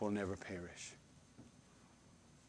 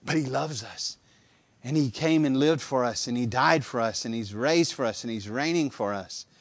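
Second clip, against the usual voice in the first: louder, rising preacher's cadence; preaching refrain; 6 dB louder than usual. Acted. Preacher to congregation about Christ, fervent preaching. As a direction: build each phrase like a hammer blow.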